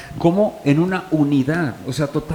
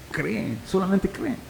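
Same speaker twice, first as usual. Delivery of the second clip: softer, higher, drawn-out plea; a slow, hushed urging to believe; 5 semitones higher, 7 dB quieter than usual. Retold or imitated. Preacher voices Jesus urging the sisters to believe, soft urging.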